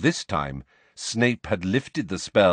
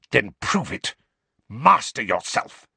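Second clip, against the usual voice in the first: spitting. Acted. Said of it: "spat Snape".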